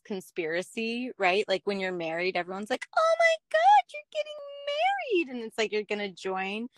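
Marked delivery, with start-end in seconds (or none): 3.0-5.4 squealed way up high, imitating an excited friend; high-pitched fake delight at the news; mock gushing excitement. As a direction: gush like everyone who loves weddings. Friends gushing over an engagement.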